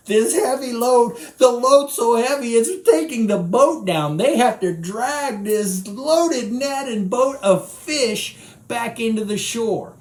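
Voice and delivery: loud, high, pressing sermon voice; preaching. Acted. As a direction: drive the heavy load home. Preaching the heavy haul.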